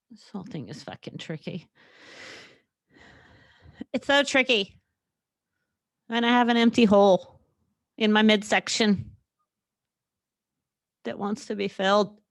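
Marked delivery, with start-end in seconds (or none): 3.9-12.2 words broken by burps; burping. Real, not acted. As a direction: talk through a burp. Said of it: "I'm really sorry that I'm burping so much".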